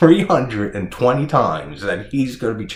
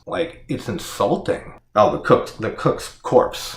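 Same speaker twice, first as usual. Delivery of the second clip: ordinary talk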